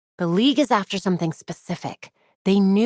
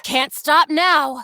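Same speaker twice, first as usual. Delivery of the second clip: straining shout; 7 semitones higher, 5 dB louder, much slower than usual. Acted.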